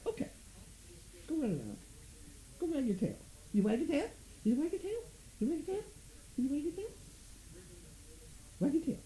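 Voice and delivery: high rising coax to the dog. Real, not acted. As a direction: lure the tail wag out of him. Coaxing dog to wag tail.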